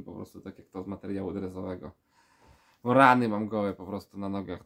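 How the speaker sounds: ordinary delivery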